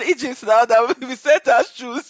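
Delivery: high and loud, laughing through the words. Retold or imitated. Brother laughing off the made-up niece, laughing hard. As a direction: barely get it out for laughing.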